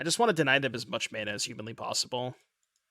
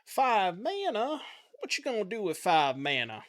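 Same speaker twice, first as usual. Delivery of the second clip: put-on sudden playful American Southern accent, pitched up on the first question; 8 semitones higher than usual. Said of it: "a southern accent there".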